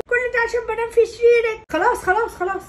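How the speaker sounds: a wounded granny protesting; offended grandma. Acted. Grandmother complaining. (جدة زعلانة بصوت أعلى)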